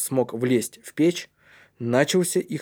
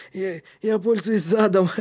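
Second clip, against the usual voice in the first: high voice broken by chuckles; laughing; 7 semitones higher than usual. A narrator's reading. Кнут jokes about how he climbs, laughing. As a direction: chuckle through the words. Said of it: "рассмеялся Кнут".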